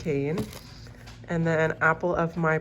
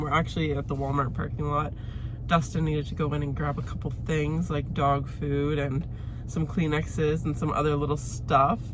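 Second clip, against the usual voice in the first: ordinary talk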